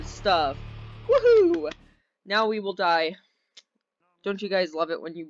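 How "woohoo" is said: high, loud woohoo; a real delighted whoop; excited whoop. Real, not acted. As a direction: cheer it like a thrill ride. Cheering down the slide.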